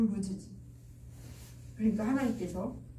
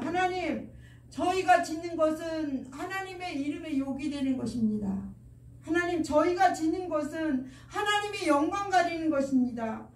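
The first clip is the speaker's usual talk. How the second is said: acted lament to God, pitched higher; crying out in prayer; 4 semitones higher than usual. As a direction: plead earnestly with raised pitch, phrase after phrase. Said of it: "하나님 앞에 이렇게 울부짖습니다".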